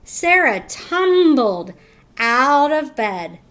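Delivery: ordinary delivery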